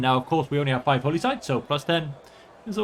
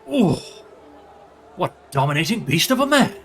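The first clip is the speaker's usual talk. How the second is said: a gasping, awed courtier; admiring onlookers; 5 dB louder than usual. Retold or imitated. Onlookers admiring the king's prizes, awed gasp. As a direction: swoon over the man. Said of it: "everyone sees my prizes and goes".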